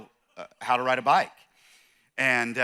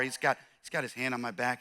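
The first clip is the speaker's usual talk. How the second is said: ordinary talk, nothing marked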